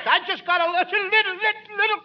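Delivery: sneeze build-up. Acted.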